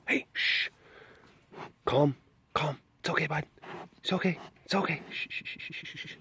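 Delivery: soft hushing reassurance; soothing. Real, not acted. Owner calms the excited pet on coming home, soft soothing. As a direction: settle an excited animal with gentle shushes.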